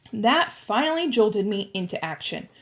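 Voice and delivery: ordinary delivery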